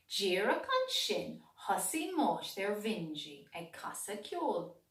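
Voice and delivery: usual speaking voice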